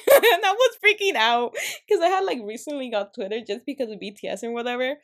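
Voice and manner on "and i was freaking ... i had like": higher and louder, laughing through the words. Real, not acted. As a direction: laugh at how panicked you were. Laughing at her own panic.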